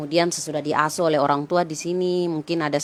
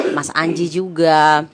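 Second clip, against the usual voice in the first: ordinary talk, nothing marked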